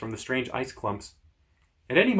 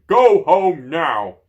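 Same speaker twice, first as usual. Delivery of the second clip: slow, drawn-out booming roar, higher and louder; giant roaring slowly; 6 semitones higher, 11 dB louder, much slower than usual. Acted. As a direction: stretch each word into a huge command. Giant yelling at dragon.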